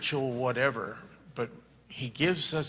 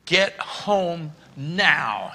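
slow, louder, higher-pitched demand; urgent command; 5 semitones higher, 7 dB louder, much slower than usual. Retold or imitated. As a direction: order him home, each word pressed. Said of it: "and says".

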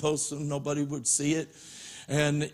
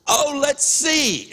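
higher, louder, slow and drawn-out sister's voice; chirpy little sister; 10 semitones higher, 7 dB louder, slower than usual. Retold or imitated. Little sister innocently about to flip the switch, drawn-out chirp. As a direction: play the curious kid sister, stretching it out.

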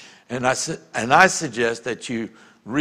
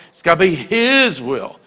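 "his" stretched out and pushed up; long, emphatic "his"; emphasis on HIS; 3 dB louder than usual. Shown as a demonstration. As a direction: hit "his" hard and hold it. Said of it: "I'm gonna put emphasis on".

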